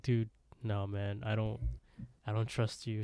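softly voiced, guarded refusal; wary refusal. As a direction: turn them down quietly, retelling it. Retelling a distrustful refusal.

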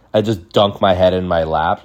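ordinary talk

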